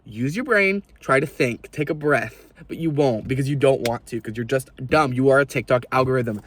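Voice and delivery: usual speaking voice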